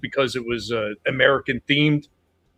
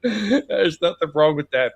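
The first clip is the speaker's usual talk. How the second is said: laughing; 5 semitones higher, 3 dB louder, slower than usual. Real, not acted. Laughing at own provocative joke.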